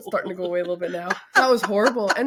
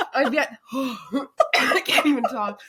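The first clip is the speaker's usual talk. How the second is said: gasping in pain; 2 semitones higher, 5 dB louder, much slower than usual. Real, not acted. Unable to close her mouth after hot sauce, gasping slowly. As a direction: gasp mid-line, words stretched slow and laughing.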